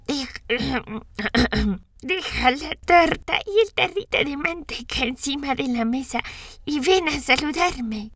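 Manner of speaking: voz de abuela fingida por el lobo; el lobo imita a la abuela, lento al final; lobo disfrazado de abuela. A narrator's reading. (lobo haciéndose la abuela)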